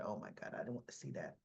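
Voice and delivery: dropped to a near whisper, dreading it; hushed aside. Real, not acted. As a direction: mutter it under your breath like you can't bear to watch. Recapping a dreaded storyline.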